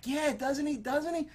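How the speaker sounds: put-on high, eager girlish agreement; girlfriend voice. Girlfriend eagerly backing him up to dad. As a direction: gush in agreement. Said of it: "And the girl's like".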